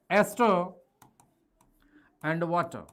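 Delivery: normal talking voice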